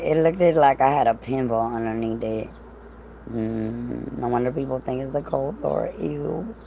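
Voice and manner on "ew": ordinary delivery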